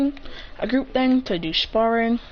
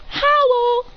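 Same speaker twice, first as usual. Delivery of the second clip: high, loud hello; bright greeting; 4 dB louder than usual. Retold or imitated. A ninja greeting his friends on arrival, high and loud. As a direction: sing it out high.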